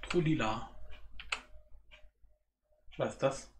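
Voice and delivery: ordinary talk, nothing marked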